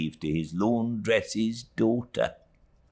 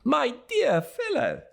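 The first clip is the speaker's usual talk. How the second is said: put-on much higher, gushing old friend; beaming, breathless welcome; excited Traddles. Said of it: "said Traddles".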